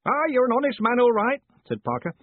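put-on high, loud bluff old man; old Parker roaring. A narrator's reading. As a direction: roar it like a deaf old soldier.

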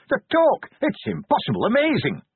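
high, loud and thrilled; bursting with amazement; excited shout. Acted. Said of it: "An excited voice behind him shouted".

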